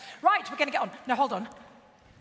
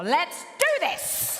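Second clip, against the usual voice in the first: slow, high, projected rallying cry; rousing kickoff; 6 semitones higher, 3 dB louder, slower than usual. Real, not acted. Host opens the Olivier Awards, rousing cry. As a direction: launch the show with a big cry.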